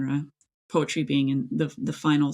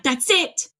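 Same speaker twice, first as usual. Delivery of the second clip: higher and louder, thrilled; triumphant eureka; excited discovery; 9 semitones higher, 4 dB louder than usual. Retold or imitated. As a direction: relive finding the lost poem. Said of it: "I'm like".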